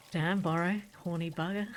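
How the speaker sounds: ordinary talk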